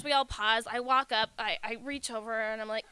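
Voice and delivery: usual speaking voice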